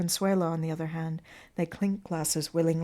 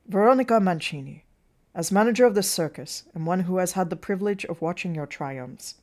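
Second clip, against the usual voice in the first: loud, projected opening of a manager's speech; formal tribute; 8 dB louder than usual. Acted. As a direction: announce her full name to the room. Burkett begins a formal speech.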